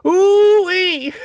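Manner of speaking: very high, loud squealing cheer; Mr. Poopybutthole impression. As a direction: squeal it out gleefully. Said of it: "the character ... on Rick and Morty".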